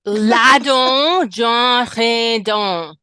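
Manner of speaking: loud, drawn-out fake pirate language; mock pirate tongue. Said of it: "That is a pirate phrase".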